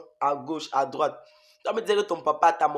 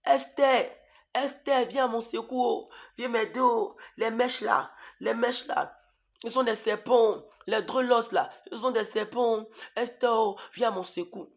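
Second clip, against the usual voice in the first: high, pleading calls for Esther; calling out to sister; 5 semitones higher than usual. Retold or imitated. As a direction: cry out for help to a sister. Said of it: "Je criais".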